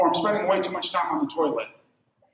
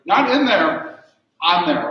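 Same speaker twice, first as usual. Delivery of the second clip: "Not in there" slow, then "on there" punched on "on"; stress on "on"; 4 dB louder, much slower than usual. Shown as a demonstration. Comedian correcting the audience, stressing "on". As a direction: lean on "on" and "in" in turn. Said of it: "Notice I said on there".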